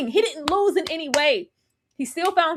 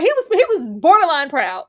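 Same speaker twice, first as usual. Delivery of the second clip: ordinary talk, nothing marked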